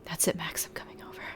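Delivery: whispered. Acted. First reading of the text, whispered.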